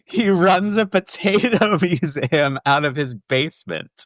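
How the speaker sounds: words squeezed out through laughter; laughing helplessly mid-sentence. Real, not acted. Telling a friend about the potato museum, laughing.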